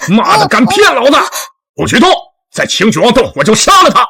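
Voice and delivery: high-pitched, furious yelling; cornered kidnapper shouting threats; enraged threat. Acted. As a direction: lash out and warn them off.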